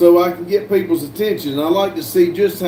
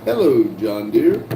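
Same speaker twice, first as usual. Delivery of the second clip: low, playful hello; mock deep greeting; 5 semitones lower than usual. Streamer greeting John Deere in chat, mock deep voice.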